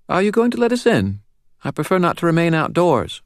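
put-on high, serious child's voice with a faint British lilt; serious little girl, faint British accent. A narrator's reading. Narrator voicing the girl to Dresden, serious and high. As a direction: speak like a grave little girl.

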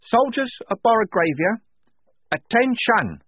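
put-on high, loud, small-girl command; reedy little voice. A narrator's reading. Wazza commanding the dead soldiers, reedy and high. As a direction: call troops to order in a high reedy tone. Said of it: "her reedy little tone".

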